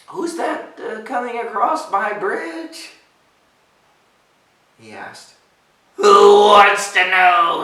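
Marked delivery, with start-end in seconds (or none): 0.1-2.9 high, slow, hesitant question; nervous ogre. Acted. Nervous ogre asks bigger goat. 6.0-7.6 loud, drawn-out snarl; snarling goat. A narrator's reading. Ruff challenging little ogre, slow loud snarl. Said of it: "snarled Ruff".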